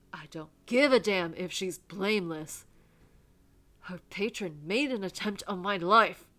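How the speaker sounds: loud, forceful tirade; angry rant. Acted. Reverend rejecting Jory's plea for her, loud and angry. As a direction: rail at him with mounting exasperation.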